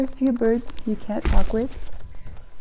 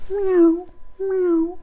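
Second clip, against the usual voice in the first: high playful meow; cat meows; 9 semitones higher than usual. Pretend. Imitating cat to a bird.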